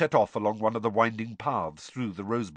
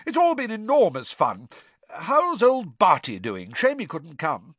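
hearty, high and loud; jovial official, beaming; cheerful Bagman; 8 semitones higher, 6 dB louder than usual. Acted. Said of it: "Bagman said cheerfully".

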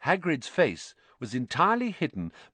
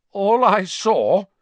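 stubborn; 11 semitones higher, 9 dB louder, much slower than usual. A narrator's reading. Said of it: "said Ernie stubbornly".

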